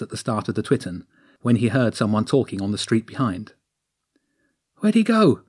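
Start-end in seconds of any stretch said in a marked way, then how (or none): 4.8-5.4 high, louder breathless youth call. Acted. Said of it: "a breathless voice called out".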